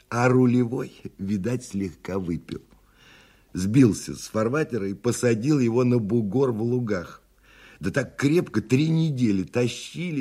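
put-on high-pitched old man spinning a yarn; sly old storyteller. Old man tells passengers of a stranded steamer, high sly voice.

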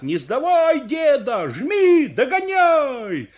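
high, loud cheering yells; spectators' full-voiced urging shout; crowd shouting. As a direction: yell him on, stretching the last word. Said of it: "и кричат".

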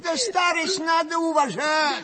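high-pitched, worked-up telling-off; old man's indignant outburst; indignant scolding. Acted. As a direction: scold the one who is laughing at you. Old man scolds a laughing listener.

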